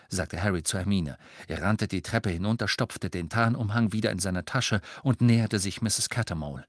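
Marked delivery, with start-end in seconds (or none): none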